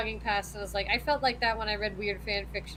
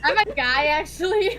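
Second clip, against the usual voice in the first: smug declaration; 6 semitones higher, 8 dB louder than usual. Acted. Body announcing it's male.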